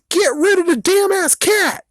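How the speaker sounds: high, loud mocking shriek; mocking the neighbors. Mocking the angry neighbors in a high shriek. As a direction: squeal the demand like an angry mob. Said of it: "mocking the neighborhood's demand".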